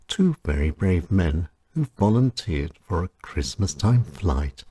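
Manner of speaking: usual speaking voice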